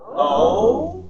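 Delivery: long, loud "Oh" rising like a question; not understanding. Shown as a demonstration. Group exercise meaning I really don't understand, puzzled. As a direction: draw out a puzzled, climbing "Oh". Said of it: "I really don't understand".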